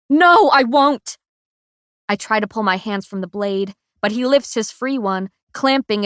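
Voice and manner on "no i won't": loud, high, slow anguished no; defiant sobbing shout; desperate refusal. A narrator's reading. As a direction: refuse through tears. Refusing to help kill him.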